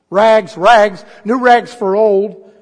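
loud, high ringing tenor cry of a rag seller; street call. Acted. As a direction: sing it out down the alley. Ragman calling through the streets.

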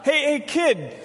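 put-on lazy parent calling the kid from the couch; hammed-up holler up to the kid; mock yell upstairs. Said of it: "So you yell up".

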